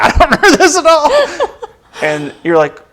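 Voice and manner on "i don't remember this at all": loud, fast, very high mock distress; laughing through a high retold wail; hammed-up wail. Retelling shock at the movie's ending, mock wailing.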